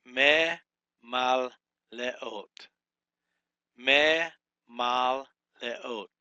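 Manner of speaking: Me, mal, leot each said apart and loud; syllable by syllable. Shown as a demonstration. Teacher breaking a Hebrew word into syllables for students. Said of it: "Put a break right there".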